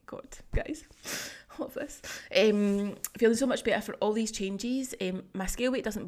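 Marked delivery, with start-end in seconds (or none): 0.1-2.0 breathy awed whisper to the viewers; real touched whisper; emotional whisper. Real, not acted. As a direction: whisper it, overcome. Moved by client's feedback.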